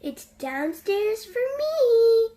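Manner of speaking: cheerful storybook yell. A narrator's reading. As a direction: call it out gleefully. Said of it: "yelled Fred".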